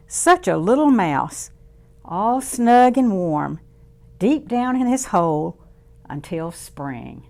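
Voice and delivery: lively storybook voice for little ones; reading to children. A narrator's reading. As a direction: read it warmly to a circle of kids. Reads picture book to children.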